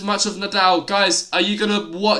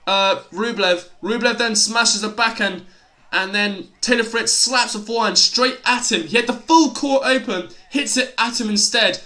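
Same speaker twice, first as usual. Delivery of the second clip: live play-by-play; 3 semitones higher than usual. Real, not acted.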